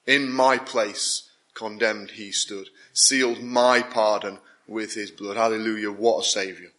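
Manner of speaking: reverent, slowed reading of a hymn verse; recited hymn. Acted. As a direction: savour each line of the old hymn slowly.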